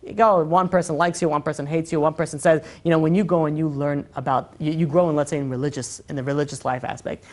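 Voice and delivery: ordinary delivery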